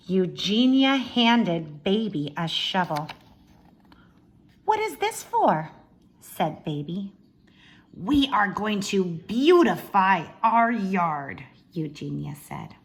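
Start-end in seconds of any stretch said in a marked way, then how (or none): 4.6-5.7 much higher, meek little sister; timid Baby voice. Acted. Baby voiced in read-aloud. 7.9-11.4 put-on louder, slow, haughty older sister; bossy Eugenia. Eugenia voiced in read-aloud.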